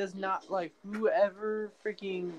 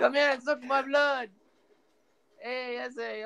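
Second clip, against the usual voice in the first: lightly put-on Mexican accent, higher; mock Mexican accent; 6 semitones higher than usual. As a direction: tease in a cartoonish Mexican voice. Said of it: "That was so racist".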